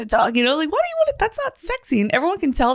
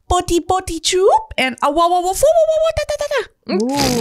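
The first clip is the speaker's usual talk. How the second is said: higher mock baby babble; baby noises; 7 semitones higher than usual. Reading the wife's baby noises aloud, higher babble. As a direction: babble like a toddler. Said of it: "She would make noises".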